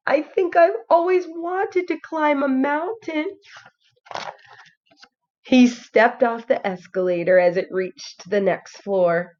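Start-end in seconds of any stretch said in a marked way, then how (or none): 0.1-3.4 higher, dreamy little bear voice; imagine climbing a mountain; wistful wonder. A narrator's reading. Toy bear dreaming of climbing a mountain. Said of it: "he wondered".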